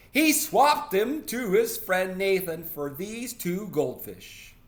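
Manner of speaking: high, loud, slow accusation; tattling little sister. Acted. Little sister telling mother about the swap, tattling. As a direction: blurt it high and loud, stretching words.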